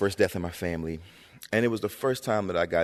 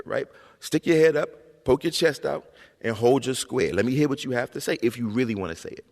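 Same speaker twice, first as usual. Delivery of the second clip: higher, emphatic mother giving orders; commanding mother; 6 semitones higher, 3 dB louder than usual. Retold or imitated. Mother across the room to son, firmly coaching. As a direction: imitate mom drilling her child to speak up. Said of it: "she walked to the other side of the room".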